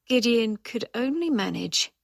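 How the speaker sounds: ordinary delivery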